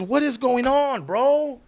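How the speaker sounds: high, drawn-out disbelief; baffled exasperation. Retold or imitated. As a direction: stretch it out, totally at a loss. Baffled at finding no record.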